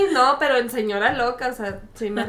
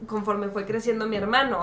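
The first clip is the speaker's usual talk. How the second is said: normal talking voice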